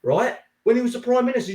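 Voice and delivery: ordinary talk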